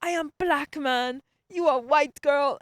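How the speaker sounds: high, loud mock African accent. Student imitating professor protesting to her, African accent. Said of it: "I don't know where his accent was from".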